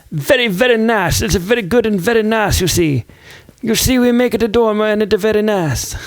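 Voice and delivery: high, singsong mock French; dubious French accent. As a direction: put on a bad, playful French accent. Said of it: "don't even know what accent that it was. That is supposed to be, like, French".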